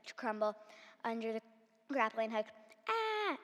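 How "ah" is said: short high startled shout. A narrator's reading. Grinch as the cliff crumbles, startled shout. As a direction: yelp as the rock gives way. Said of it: "the Grinch shouted".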